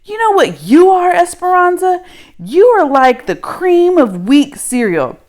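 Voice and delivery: high, loud jeering insult; taunting. Acted. Girl jeering at Esperanza, high and loud.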